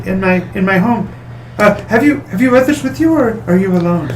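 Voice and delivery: high, prim lady voice; demure noblewoman. Acted. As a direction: speak like a proper flustered hostess.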